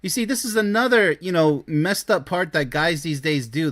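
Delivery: ordinary delivery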